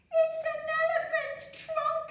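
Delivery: very high, loud wailing princess; distressed princess. Acted. Princess tells her mother, high distressed wail. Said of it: "Princess Prunella said".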